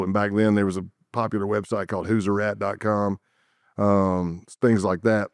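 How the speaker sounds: normal talking voice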